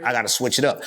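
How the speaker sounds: usual speaking voice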